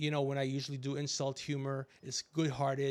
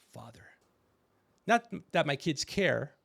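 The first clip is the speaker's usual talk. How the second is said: usual speaking voice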